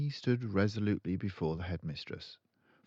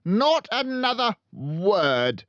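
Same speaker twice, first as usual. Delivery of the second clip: shouted; 12 dB louder than usual. A narrator's reading. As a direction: cut her off with a shout. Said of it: "shouted Miss Trunchbull".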